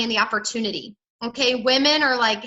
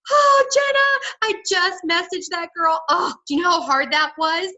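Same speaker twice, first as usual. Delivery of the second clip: acted excited relief; 5 semitones higher, 3 dB louder than usual. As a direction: gush to a friend, hammed-up. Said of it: "you're gonna be like".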